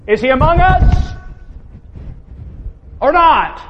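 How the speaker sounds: heated challenge. Acted. Preacher voicing the testing Israelites, mildly heated. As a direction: fling the doubt back at God, worked up. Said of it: "And you get just that heated".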